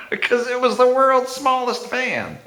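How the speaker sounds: high-pitched, laughing through the words. Real, not acted. Man joking about the world's smallest fan, laughing. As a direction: crack up while delivering the punchline. Cracking up at own story.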